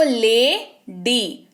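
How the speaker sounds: "Lady" very slowly, split as ले, then डी; teacher sounding out both syllables of "lady". Shown as a demonstration. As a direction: break "lady" into two separate drawn-out beats. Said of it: "double syllable सुनाई दे रहा है आपको".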